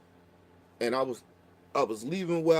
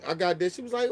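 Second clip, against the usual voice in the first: usual speaking voice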